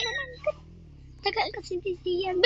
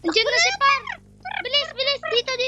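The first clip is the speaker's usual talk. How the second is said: frantic; 7 semitones higher, 4 dB louder than usual. Real, not acted. Monster approaching, rushing.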